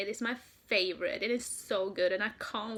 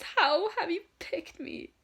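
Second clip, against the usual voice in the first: higher and louder, overwhelmed; amazed question; 8 semitones higher, 6 dB louder than usual. Retold or imitated. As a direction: blurt it out in awe. Retold amazement at being chosen.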